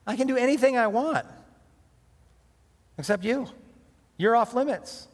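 put-on a young man protesting hotly to his master's wife; a touch of indignant Joseph; Joseph refusing firmly. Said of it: "And so Joseph says".

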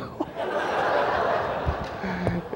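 breathy chuckle; airy laugh. Real, not acted. Laughs at memory.

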